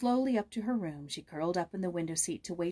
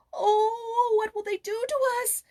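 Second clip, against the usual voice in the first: put-on high, loud, near-crying boy's voice; a scared little goatherd blurting out his fear; a boy's panicky cry, pitched high; frightened gasp; 7 dB louder than usual. A narrator's reading. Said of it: "he gasped".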